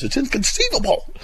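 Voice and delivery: hammed-up high-pitched film quote; movie catchphrase. Host quoting Princess Bride, in a high hammed-up voice. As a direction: pitch it way up and drop at the end. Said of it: "Princess Bride".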